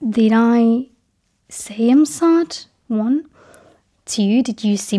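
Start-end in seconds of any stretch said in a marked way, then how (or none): none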